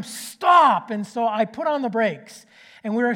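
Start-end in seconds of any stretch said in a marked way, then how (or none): 0.0-0.9 loud, high shouted stop; a father hollering at his son to brake. Retold or imitated. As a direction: yell it like a last warning. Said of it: "he hollers a third time".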